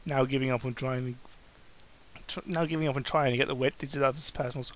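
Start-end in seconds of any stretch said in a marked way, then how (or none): none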